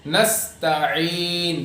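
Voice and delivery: very slow, elongated نستعین; stretched recitation of نستعین; clear seen. Shown as a demonstration. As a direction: hiss a light s in نستعین, then a throaty ain. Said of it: "یہاں پہ سین ہے".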